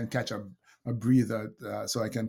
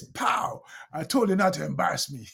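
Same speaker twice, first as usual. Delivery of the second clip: put-on much higher, fast kid's voice with a loud pow; scolding kid; 6 semitones higher, 4 dB louder than usual. Dad imitates his son slapping him after class, scolding. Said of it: "the kid slapped me".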